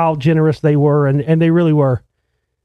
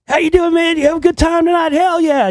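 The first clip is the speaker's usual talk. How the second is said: high, shouting buddy voice; rowdy party cheer; hyped greeting; 12 semitones higher than usual. Retold or imitated. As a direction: pump it up like a partying rocker. Bandmates hyped at the club.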